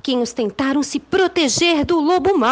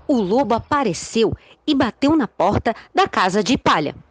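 usual speaking voice

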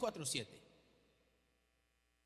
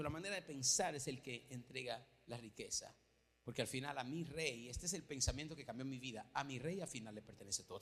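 quiet earnest; 8 semitones lower, 10 dB quieter than usual. Real, not acted. Preacher lowers to earnest point.

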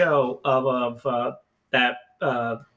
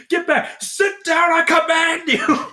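high-pitched yelled order, slowing at the end; hammed-up panicked commanding shout; mock frantic command; 12 semitones higher, 4 dB louder, slower than usual. Retells panicked man ordering people.

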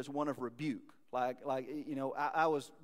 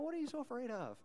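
put-on reassuring parent to child; 9 semitones higher, 5 dB quieter, faster than usual. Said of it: "when your five-year-old's scared of monsters".

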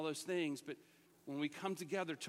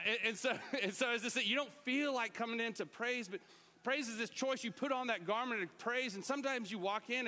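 ordinary talk, nothing marked

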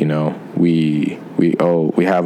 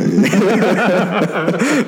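high laugh; 13 semitones higher than usual. Real, not acted. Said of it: "Oh, I'm not this way".

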